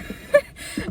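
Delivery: short high laugh. Real, not acted. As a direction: burst out laughing. Laughs after fuck off.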